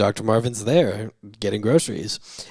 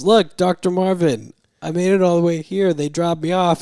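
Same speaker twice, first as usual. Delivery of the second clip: eager; 7 semitones higher, 4 dB louder than usual. Retold or imitated. Patient proudly calling at door.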